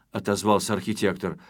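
ordinary delivery, nothing marked

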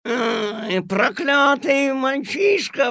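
high, slow, piercing shriek. Acted. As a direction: screech it in fury. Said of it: "взвизгнула".

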